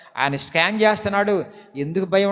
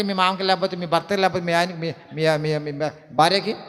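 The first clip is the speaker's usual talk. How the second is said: ordinary talk, nothing marked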